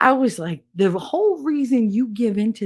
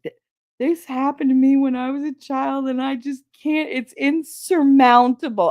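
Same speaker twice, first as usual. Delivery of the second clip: whiny coworker; 6 semitones higher, 5 dB louder than usual. Retold or imitated. Mocking coworker's trauma complaint.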